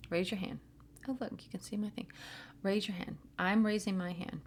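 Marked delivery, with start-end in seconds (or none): 1.1-2.1 quick unvoiced whisper aside. Real, not acted.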